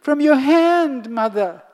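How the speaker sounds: put-on high pleading little boy; pleading child. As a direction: beg sweetly like a child. Krishna pleads with mother.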